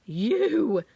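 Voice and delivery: mildly gasped, accusing cry; a touch of shocked accusation. A narrator's reading. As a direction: point the finger with your voice. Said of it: "she gasped".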